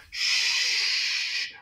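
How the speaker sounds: long voiceless shush; whispered shush. A narrator's reading. The principal hushes children at the roof door, whispering. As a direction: hush them with a long whisper. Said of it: "whispered Mister Klutz".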